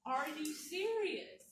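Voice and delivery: slow, high-pitched incredulous question; retold mad disbelief. Speaker retells confronting a careless paramedic, mad and incredulous. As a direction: draw it out, indignant and appalled. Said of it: "I was so mad".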